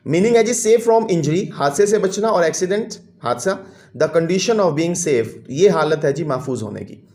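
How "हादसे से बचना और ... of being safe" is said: ordinary delivery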